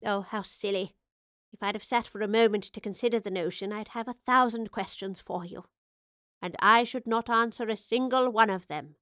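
lightly voiced old woman, playful; a hint of a knowing old woman teasing; elderly professor. Old professor teases younger self.

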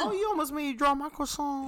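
Vine quote voice. Retold or imitated. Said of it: "we spoke in Vine".

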